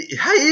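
high, stretched-out shrieked "hey"; alien war cry. Acted. Gamer voicing a menacing alien's opening cry, war cry. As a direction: howl a long, high battle yell.

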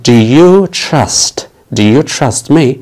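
very slow, you stressed; slow teaching read. Shown as a demonstration. Teacher models the question slowly for students. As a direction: spell it out slowly for the class. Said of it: "هل تثق فيني؟".

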